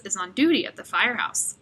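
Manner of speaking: ordinary delivery, nothing marked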